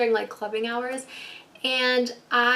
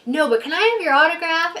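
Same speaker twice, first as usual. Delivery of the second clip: eager; 5 semitones higher, 6 dB louder than usual. Retold or imitated. Eager cop fan.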